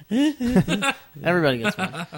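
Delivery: slow, laughing repeat. Real, not acted. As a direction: say it again through a laugh.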